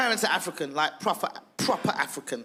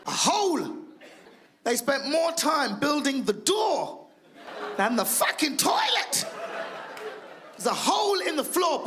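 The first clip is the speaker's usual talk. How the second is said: outraged shout; 9 semitones higher than usual. Acted. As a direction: rant at the audience in disbelief. Outraged rant about toilet.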